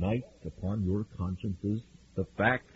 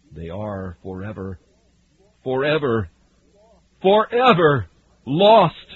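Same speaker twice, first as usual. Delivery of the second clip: building forever; 2 semitones higher, 8 dB louder than usual. Acted. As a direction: swell each forever until it rings out. Preacher building the word forever.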